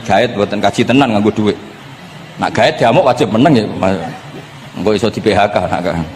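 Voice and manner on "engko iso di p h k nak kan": normal talking voice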